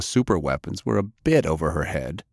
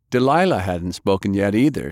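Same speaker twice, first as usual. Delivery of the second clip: usual speaking voice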